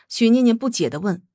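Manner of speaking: usual speaking voice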